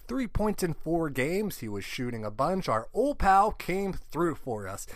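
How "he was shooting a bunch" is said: ordinary delivery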